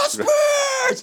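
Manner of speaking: very high shouted name; loud answer yell. Pretend. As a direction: yell it back like a crowd. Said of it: "yell so loud".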